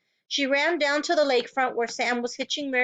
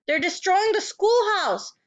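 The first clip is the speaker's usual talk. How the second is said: higher, slower, out of breath; breathless; 8 semitones higher, 3 dB louder than usual. A narrator's reading. Teacher to sheriff, gasping for breath. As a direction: gasp it out after running. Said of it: "gasping for breath".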